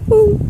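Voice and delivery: high, loud mock pigeon coo; playful imitation of a cooing pigeon. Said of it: "the pigeons going woo".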